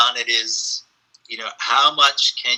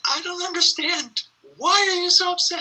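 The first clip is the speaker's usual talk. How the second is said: much higher, puzzled voice for the dresser; baffled dresser. Retold or imitated. Dresser asking Olivier why he is upset, much higher and puzzled. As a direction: pitch way up, bewildered and pleading. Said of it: "the man said".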